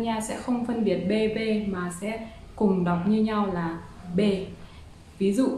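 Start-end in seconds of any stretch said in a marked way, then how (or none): none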